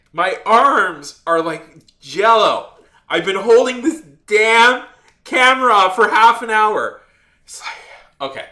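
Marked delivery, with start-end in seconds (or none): 0.1-7.0 loud, drawn-out complaint; worked-up protest at high volume; exasperated venting. Retold or imitated. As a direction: wail it out, stretching the key words. Venting loudly about holding camera.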